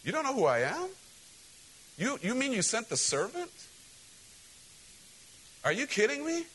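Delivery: high, incredulous outrage at the slight; indignant outrage. Acted. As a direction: play the offended big shot who can't believe it. Naaman offended, repeated.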